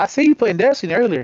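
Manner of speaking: friendly, animated. Retold or imitated. Investigator turning friendly to the nervous player, animated. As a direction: lift the pitch as if chatting up a fellow gamer.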